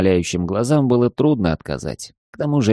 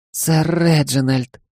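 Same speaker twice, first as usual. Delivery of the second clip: happy smiling maid; 7 semitones higher, slower than usual. Acted. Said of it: "Она счастливо улыбнулась".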